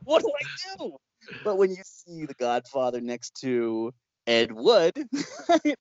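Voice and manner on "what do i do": high squeaky mock panic. Filmmaker shown The Godfather, panicking in mock fright. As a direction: play a clueless newbie freaking out.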